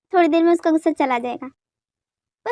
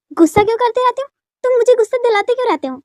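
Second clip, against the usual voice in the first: higher, annoyed retort; angry; 5 semitones higher, 3 dB louder than usual. Acted. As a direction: throw the accusation straight back. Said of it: "हमेशा गुस्सा क्यों करती रहती हो". (गुस्से में पलटकर जवाब)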